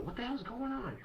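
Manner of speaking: very high mock-mom voice; imitating mom. Son retells mom walking in on the fight, high-pitched imitation. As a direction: mimic an upset mother in high falsetto. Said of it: "and my mom comes up".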